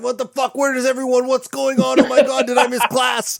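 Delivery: high, far-off panicked mock voice; confused student. Teacher imitates the student waking in an empty room, high and panicky. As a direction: play the kid waking up alone, lost. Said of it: "He's like".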